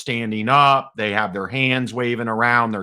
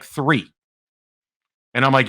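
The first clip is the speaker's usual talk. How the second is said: ordinary talk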